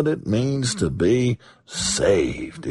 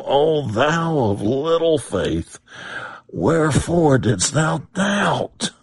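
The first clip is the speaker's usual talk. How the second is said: slow, higher, tearful words of Jesus; Jesus's gentle rebuke; 5 semitones higher, slower than usual. Acted. Preacher voicing Jesus rebuking Peter's doubt, slow and tearful. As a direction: let the rebuke break with tenderness.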